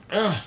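sharp high yelp of frustration; frustrated cry. Real, not acted. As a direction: yelp as the lid won't budge. Jar lid won't open.

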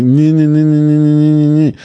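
put-on high nasal-free childish nee-nee taunt; mocking the Democrats with a childish nee-nee; mocking whine. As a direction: taunt like a whiny kid. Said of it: "just being like".

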